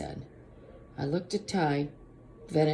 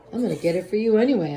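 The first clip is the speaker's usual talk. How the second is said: usual speaking voice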